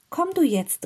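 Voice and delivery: high, loud call to a sister; boy calling out, high and loud; calling over. A narrator's reading. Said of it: "rief er seiner Schwester zu".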